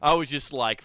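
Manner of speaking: ordinary talk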